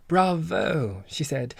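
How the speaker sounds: high, loud delighted cry; exclaiming bravo; bright bravo. Acted. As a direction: cheer the answer. Lucia applauds the answer.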